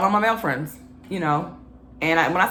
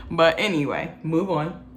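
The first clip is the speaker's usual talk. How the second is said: ordinary talk, nothing marked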